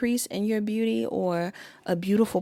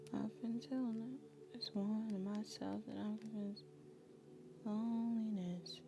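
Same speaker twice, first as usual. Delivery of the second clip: hushed, breathy half-whisper, much softer; soft whispered lyrics; 13 dB quieter than usual. Acted. Reader whispers Paramore lyrics to her viewers, hushed. Softly reading song lyrics aloud.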